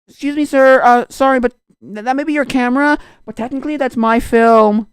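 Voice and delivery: high, loud, mock-earnest protest; acted objection. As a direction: imitate a kid challenging an adult. Acting out character challenging an adult.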